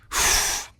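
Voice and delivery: voiceless whoosh of breath; sharp blown-out breath. Retold or imitated. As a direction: blow air out as a rush. Imitates adrenaline going crazy.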